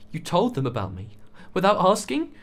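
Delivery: hurt fury at being betrayed; hot anger. A narrator's reading. Young man to friend who exposed him, hot anger. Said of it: "Jessie addressed Finn hotly".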